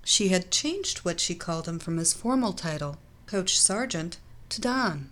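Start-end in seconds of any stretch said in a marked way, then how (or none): none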